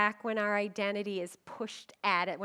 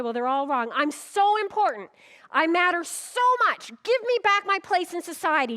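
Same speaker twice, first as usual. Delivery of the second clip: loud, higher indignant demand; mock entitled complaint, much louder and higher; self-important; 6 semitones higher, 8 dB louder than usual. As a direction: demand your due, puffed up.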